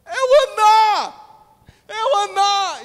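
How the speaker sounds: high, loud ringing cry; excited shout. Acted. Villager shouting the name to the crowd, high and loud. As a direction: call out the name like town news. Someone spots him in the street.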